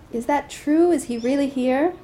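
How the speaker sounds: put-on delighted to hear an old friend has come; excited. A narrator's reading. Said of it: "the Buddha was very excited".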